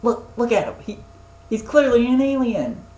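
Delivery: high, loud cartoon outcry; frantic accuser. Boy pointing out the alien Zim, high frantic shout. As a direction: shout it as an exasperated whistle-blower. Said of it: "he's pointing at Zim saying".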